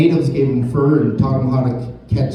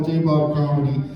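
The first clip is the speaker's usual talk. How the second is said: ordinary delivery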